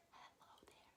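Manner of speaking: soft whispered echo of the line. Shown as a demonstration. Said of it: "like an echo".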